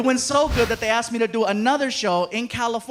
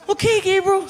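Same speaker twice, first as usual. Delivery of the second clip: put-on high little boy answering; kid voice; 10 semitones higher, 4 dB louder than usual. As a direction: sound like a small child.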